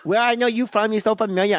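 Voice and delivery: high, put-on starstruck host; hammed-up high host voice; restaurant host character. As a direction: puzzle over a half-recognized customer in character. Restaurant host character recognizing guest.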